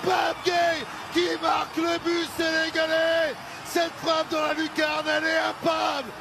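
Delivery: goal shout. Real, not acted. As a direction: roar the scorer's name. Goal celebration call.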